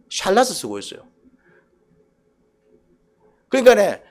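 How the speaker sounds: ordinary delivery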